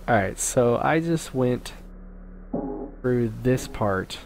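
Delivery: usual speaking voice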